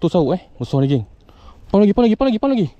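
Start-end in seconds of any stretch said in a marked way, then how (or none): 1.7-2.7 high, loud rapid repeats; urgent coaching. Real, not acted. Angler urging another pump of the rod, urgent. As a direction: egg them on loudly, high pitched.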